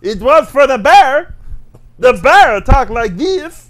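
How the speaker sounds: loud, slow, swooping mock-Russian accent. Host insists on a cartoon bear impression, loud mock Russian accent. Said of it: "It had a Russian accent".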